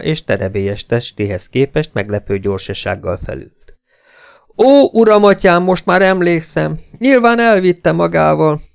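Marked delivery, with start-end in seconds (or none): 4.6-8.6 high, loud lament; loud wailing. A narrator's reading. Esmeralda remembering the abduction, wailing. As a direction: wail as the memory returns. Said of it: "elkezdett zokogni, és hangosan jalveszékelni".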